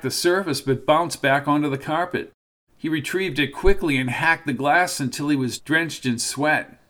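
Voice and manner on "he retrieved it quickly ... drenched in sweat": usual speaking voice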